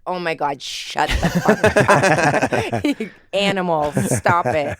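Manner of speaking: mock outburst with a laugh; drawn-out, loud "shut up" in mock exasperation; exasperated bystanders, retold. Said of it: "people would be like".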